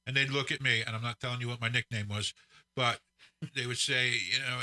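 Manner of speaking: ordinary delivery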